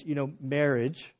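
ordinary talk, nothing marked

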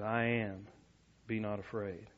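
calm quiet Jesus. Retold or imitated. Jesus calmly reassuring frightened disciples in the storm, softly. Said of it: "I don't believe that Jesus was screaming".

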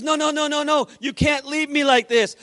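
high, urgent retold pleading; rapid-fire refusal, pitched high; excited protest. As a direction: imitate an excited man refusing to be left. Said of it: "He's so excited".

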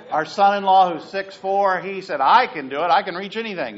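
excited loud retelling. Retold or imitated. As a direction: belt out his cocky promise. Excited retelling of the son-in-law.

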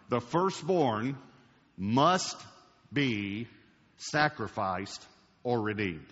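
The firstborn, must, be, each held with pauses, slowed for note-takers; slow dictation. Shown as a demonstration. Preacher slowly dictating a point to note-takers. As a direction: dictate it slowly so they can write. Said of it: "I want you to write these down".

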